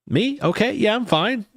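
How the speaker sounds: high, startled "Me?" then brushing it off; surprised. Real, not acted. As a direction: caught off guard by a question. Chat says she looks unwell.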